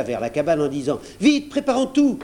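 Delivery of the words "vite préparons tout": high, loud, urgent call; rally everyone in a hurry, composure forgotten; excited urgency. A narrator's reading. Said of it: "oubliant son flegme, il se précipita".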